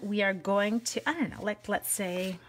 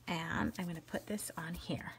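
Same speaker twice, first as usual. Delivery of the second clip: whisper; 4 semitones lower, 11 dB quieter than usual. Real, not acted. Crafting aside to viewers.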